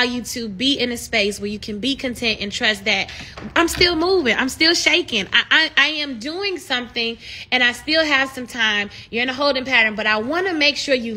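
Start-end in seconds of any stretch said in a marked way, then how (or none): none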